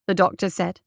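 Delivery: plain reading voice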